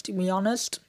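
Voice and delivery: ordinary talk, nothing marked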